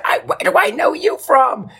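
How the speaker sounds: very high starstruck squeal; gushing lady. Retold or imitated. Customer service lady asking where she knows him from, in a very high squeal. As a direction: squeal it like an excited fan. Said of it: "I said".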